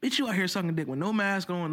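ordinary delivery

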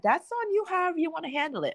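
high and fast, brushing it off; sassy retort. Retold or imitated. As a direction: toss it back at her, quick and high. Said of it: "I said".

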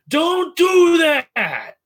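high, loud, drawn-out shout; in-character yell. Acted. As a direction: yell it slowly at full volume, pitch way up. In-game yell after kicking Domino.

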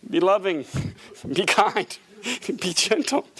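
normal talking voice

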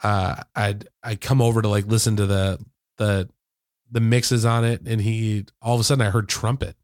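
normal talking voice

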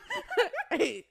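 sudden loud high-pitched laugh; loud high laugh burst. Real, not acted. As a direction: break out laughing hard. Burst of laughter at covid story.

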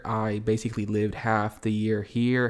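normal talking voice

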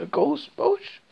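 ordinary delivery

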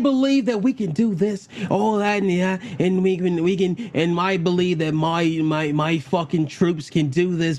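imitating the Imperial officer's British accent; mock British officer. Friends mock the officer's British accent. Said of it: "what's with the accent".

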